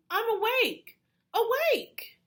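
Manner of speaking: Froggy insisting he's wide awake; crying out. A narrator's reading. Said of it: "cried Froggy".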